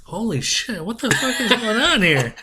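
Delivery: high, startled disbelief; mock shock. Friend in surgery room, acting stunned and high. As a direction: act out being stunned at the scene. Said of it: "I'd be like".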